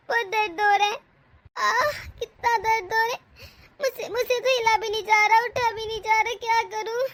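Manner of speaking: tearful groans of pain; crying in pain. Acted. Pregnant woman alone in her room, crying in pain. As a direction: moan and whimper through the pain.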